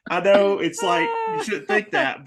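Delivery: high, loud, amused admission; laughing. Real, not acted. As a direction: protest through real laughter. Still laughing at own joke.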